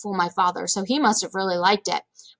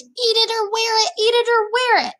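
put-on very high-pitched chanted kid scream; screaming little boy; 13 semitones higher than usual. A narrator's reading. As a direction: shriek it as a little brother's repeated taunt. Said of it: "Fudge screamed".

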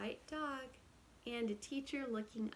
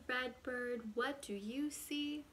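ordinary delivery

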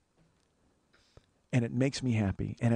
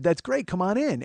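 high-pitched, eager invitation; delighted welcome; 8 semitones higher, 4 dB louder than usual. Retold or imitated. Friend inviting him into his house, delighted. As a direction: imitate a host thrilled at the visit.

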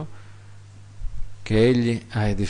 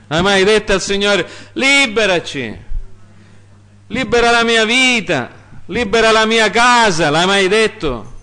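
full-force preaching; 11 semitones higher, 6 dB louder than usual. Acted. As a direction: cry the verse to God at full voice. Preacher presses the congregation. (predicazione gridata, alta e lenta)

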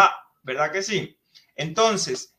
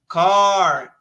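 English model word; 8 dB louder than usual. Shown as a demonstration. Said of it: "teacher models English vocabulary".

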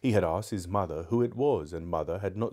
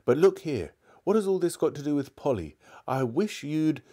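put-on higher, insistent boyish protest; impatient protest; 7 semitones higher, 4 dB louder than usual. A narrator's reading. Diggory demands to know about Polly, impatient. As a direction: break in impatiently, pitch raised.

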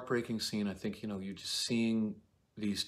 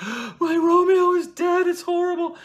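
wailing Juliet; 13 dB louder than usual. Acted. Juliet wailing to the nurse in mock grief. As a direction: overplay a grief-stricken Juliet. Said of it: "Juliet's like".

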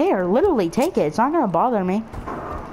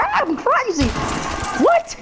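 high-pitched pretend freak-out; hammed-up crazy screaming, much higher; mock scream; 13 semitones higher than usual. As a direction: squeal it like you've playfully lost it. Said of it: "I should just scream".

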